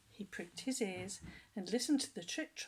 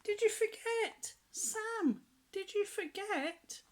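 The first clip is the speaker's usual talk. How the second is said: much higher, louder sad elephant cry; elephant bellows; 12 semitones higher, 3 dB louder, slower than usual. Acted. Hungry elephant cries out to Sam, high and pleading. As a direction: wail it up high like a hurt elephant.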